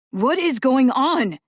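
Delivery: loud, high, slowed frightened cry; boy crying out in fear. Acted. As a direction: call out scared and lost. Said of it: "Gabriel cried".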